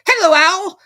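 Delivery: high little squeak; tiny excited squeaky voice; squeaky Roo. A narrator's reading. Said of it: "so he said".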